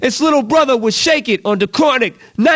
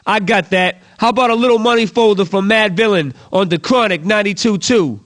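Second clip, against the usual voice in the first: usual speaking voice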